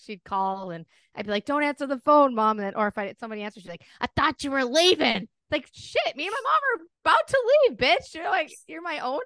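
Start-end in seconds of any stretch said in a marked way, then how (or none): 1.5-2.6 raised, louder mock urgency; imitating a kid's urgent warning; urgent retold plea. Retold plea to mom as phone rings. 4.0-5.3 higher, louder imitation of an accusation; retold challenge, pitched up; mock accusing friend. Imitates friend catching her in lie. 5.5-9.2 very high, hurried mock panic; hammed-up excuse, pitched way up; flustered retold comeback. Acts out flustered reply to friend.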